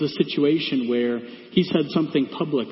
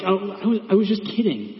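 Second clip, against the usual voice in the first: higher-pitched, stammered excuse; mock innocent backpedal; 6 semitones higher than usual. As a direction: play it off as a harmless joke. Acting out a backpedalling family member.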